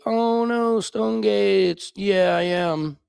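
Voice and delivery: high, fake alarmed whine; hammed-up mock panic; mock dismay. Mocking fake dismay at troll.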